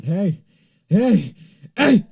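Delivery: high, very loud re-enacted yell; screaming. Screaming at the passerby who dropped cash, urgent yell. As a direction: scream it after someone walking away. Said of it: "I start screaming".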